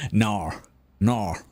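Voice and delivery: "Nar" for "no", with a curled, drawn vowel; Aussie-style "nar". Retold or imitated. Amused mimicry of an Aussie "no", said as "Nar". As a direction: imitate an Australian "no" as "Nar". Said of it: "I like the nar".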